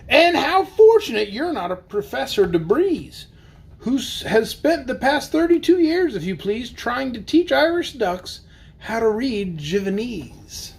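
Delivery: lively, sing-song storybook reading; bouncy picture-book narration; reading to children. Acted. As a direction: read the rhyme aloud for the kids. Reads Seuss aloud to kids.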